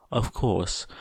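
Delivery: ordinary delivery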